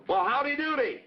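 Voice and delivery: cheery greeting. Shown as a demonstration. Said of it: "I like to say".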